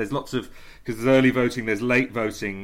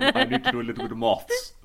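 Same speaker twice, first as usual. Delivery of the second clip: much higher posh British voice saying "moths" for maths; posh British accent; 13 semitones higher than usual. Pretend. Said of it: "if you say it in a posh voice".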